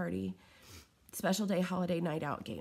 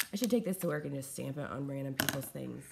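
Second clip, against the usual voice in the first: normal talking voice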